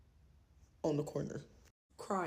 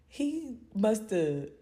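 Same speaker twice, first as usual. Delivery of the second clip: ordinary talk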